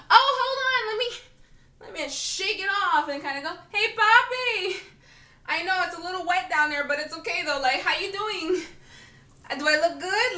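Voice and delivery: high, sing-song put-on flirting; mock flirty come-on. Mocking herself as the flirting man.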